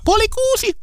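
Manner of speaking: very high-pitched imitation of a child; child's voice. Guest recalls a children's TV show's opening, mimicked child voice. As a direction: squeak it out like a little kid announcing. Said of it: "se, joka alkoi sillä lapsen äänellä".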